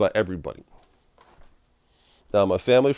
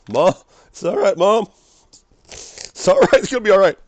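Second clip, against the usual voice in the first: loud, much higher cry of reassurance; yelling comfort; 10 semitones higher, 7 dB louder than usual. Retold or imitated. Jesus on the cross calls to his mother, loud high reassurance. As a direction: call out over the crowd to Mom.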